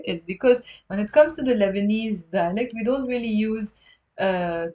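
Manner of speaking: ordinary delivery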